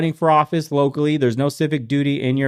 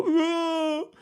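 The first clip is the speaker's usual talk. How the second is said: high, whining fan groan; mock whiny fan. Mocking a fan whining to be left alone, high. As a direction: whine like a fan who wants his game.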